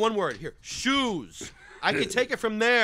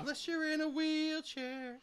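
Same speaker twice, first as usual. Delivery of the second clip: quoted voice; 10 semitones higher, 10 dB quieter than usual. Retold or imitated. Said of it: "He says".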